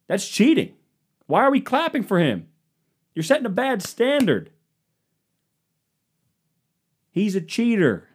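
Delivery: high, indignant whine; mock petulant grown-up; high whiny complaint. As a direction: sulk like a sore loser. Mock outrage over child cheating.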